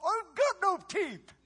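put-on high, slow little old lady voice; old lady standing up in church, high. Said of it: "she said".

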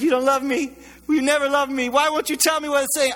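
mock pleading.

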